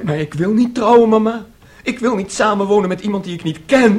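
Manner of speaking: loud, high pleading protest; upset protest. Acted. Son protesting loudly to his mother that he won't marry. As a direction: cry out against the marriage.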